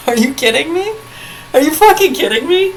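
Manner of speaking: incredulous, pitched high and rising; can't believe what she's hearing, pitch climbing; outraged disbelief. Real, not acted. Outraged at people's complaints.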